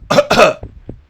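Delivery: loud, put-on coughs; signal cough. Retelling Hardcore secretly signalling Economos, loud fake coughing. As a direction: fake a pointed cough to get attention. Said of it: "give him the cough cough thing".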